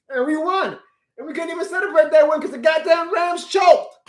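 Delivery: high, loud, fast frustrated shouting; heated rant. Real, not acted. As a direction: vent it at the fans, voice climbing. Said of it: "I'm ranting now".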